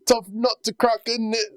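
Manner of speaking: high, hammed-up British accent; bad Peaky Blinders accent. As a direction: do a badly faked British gangster accent. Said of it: "what accent he does".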